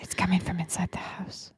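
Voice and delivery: hushed, breathy horror whisper; creepy whisper. Acted. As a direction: whisper it like a scary movie. Creepy horror movie line quoted.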